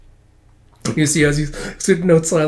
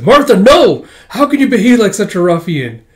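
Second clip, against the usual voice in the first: scandalised shout; 7 semitones higher, 8 dB louder than usual. Acted. Scolding the girl loudly.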